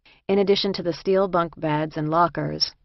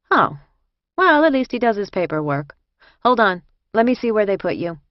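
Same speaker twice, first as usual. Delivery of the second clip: louder, lightly voiced helpful inmate; brisk prison escort; 4 semitones higher, 5 dB louder than usual. A narrator's reading. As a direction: take charge of the newcomer.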